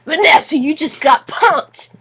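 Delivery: shrieked. Acted. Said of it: "Vanessa shrieked".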